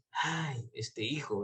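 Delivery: sighing lament. Retold or imitated. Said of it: "por eso habla ahí de respiro".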